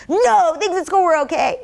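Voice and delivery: put-on high, small kid voice insisting all is fine; child's voice. Child telling the parent school was okay, high kid voice. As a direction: play the child brushing it off.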